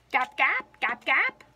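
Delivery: loud gap gap quacks; loud duck quacks. A narrator's reading.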